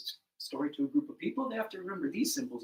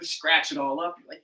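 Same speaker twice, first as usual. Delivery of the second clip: ordinary delivery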